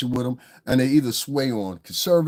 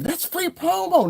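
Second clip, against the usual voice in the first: high, squeaky mock voice, much higher; mocking impression; slower than usual. Vlogger mocks YouTubers who think any mention is free promo, in a high mocking voice. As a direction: sneer it like a clueless clout chaser.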